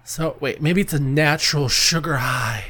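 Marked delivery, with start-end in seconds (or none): none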